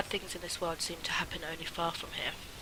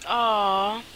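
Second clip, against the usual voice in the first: touched aww; 3 semitones higher, 6 dB louder than usual. Real, not acted. Touched by aunt's support.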